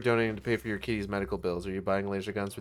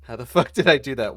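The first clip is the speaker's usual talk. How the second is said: laughing; 9 dB louder than usual. Real, not acted. Laughing at own slip.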